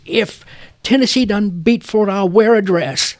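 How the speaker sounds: high, loud barroom boast. Retold or imitated. Bartender boasting to the bar crowd, loud and high. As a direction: bellow the bet across the bar. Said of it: "he says in a very loud voice".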